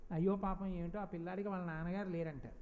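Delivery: low, soft sympathetic murmur; pitying onlooker. Retold or imitated. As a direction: voice a bystander's mild pity. Voicing onlookers pitying a fatherless child. (జాలిపడుతూ తగ్గు స్వరంలో)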